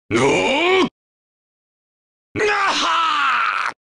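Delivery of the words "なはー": long drawn-out yell, falling away; drawn-out fighter's scream; battle cry. Acted. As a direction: hold the combat cry long.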